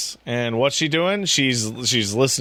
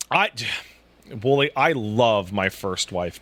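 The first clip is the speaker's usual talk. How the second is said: normal talking voice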